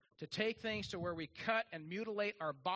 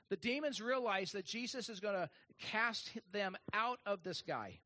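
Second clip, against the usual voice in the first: ordinary talk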